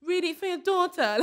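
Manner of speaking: mock guilt trip. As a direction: turn her own line back on her. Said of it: "would ever be like".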